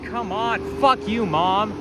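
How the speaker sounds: usual speaking voice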